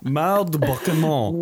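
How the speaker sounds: Mal de débarquement said the French way, uvular r; French pronunciation. Shown as a demonstration. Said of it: "Please, I'm really good".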